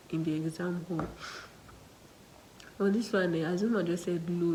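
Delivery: ordinary talk, nothing marked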